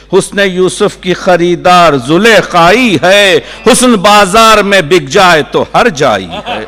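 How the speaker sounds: poetry recitation. Acted. Poet bringing his nazm to a mushaira crowd, declaiming. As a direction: carry each line through to its rhyme.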